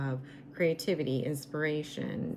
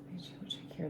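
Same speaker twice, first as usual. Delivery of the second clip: whispering; 11 dB quieter than usual. Real, not acted. Whispered aside while checking cards.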